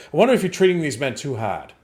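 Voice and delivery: lightly mocked uppity Massachusetts accent. Host imitating the officer questioning the sergeant's training, uppity Massachusetts accent. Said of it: "like, uppity Massachusetts accent".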